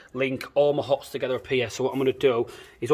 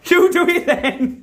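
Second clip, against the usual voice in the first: high laughing challenge; 5 dB louder, much slower than usual. Real, not acted.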